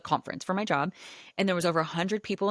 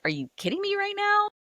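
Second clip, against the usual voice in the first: incredulous parents. Retold or imitated.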